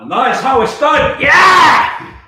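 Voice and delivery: loud, high victory cry; triumphant shout. Real, not acted. Triumphant after handstand challenge.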